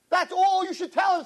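high, impassioned preacher's voice; preaching at full force. Acted. Rabbi urging congregation what to ask God, impassioned preaching. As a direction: drive each line home to the crowd.

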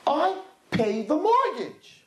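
high, slow, self-satisfied drawn out "mortgage"; proud husband. Retold or imitated. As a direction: say it like it settles everything. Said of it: "Every single guy answers".

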